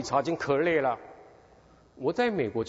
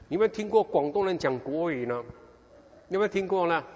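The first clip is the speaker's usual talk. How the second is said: ordinary talk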